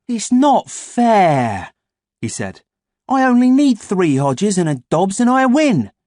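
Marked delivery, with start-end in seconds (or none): 0.1-1.7 very slow, high, loud whine; whining. A narrator's reading. Boy whines at closed door. 3.1-5.9 put-on high, loud eager boy; excited. A narrator's reading. Excited boy explains prize.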